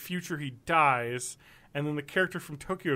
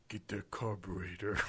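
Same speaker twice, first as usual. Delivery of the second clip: low, deep, gruff action-hero line; Vin Diesel voice; 7 semitones lower, 9 dB quieter than usual. Retold or imitated. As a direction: drop low and growl it like a movie tough guy. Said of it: "Let me try a Vin Diesel accent".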